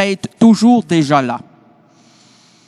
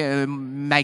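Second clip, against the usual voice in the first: ordinary talk, nothing marked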